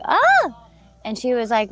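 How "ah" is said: sudden high startled scream; short high startled cry; startled shriek. Retold or imitated. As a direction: yelp at the sight of the frog. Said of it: "I was like".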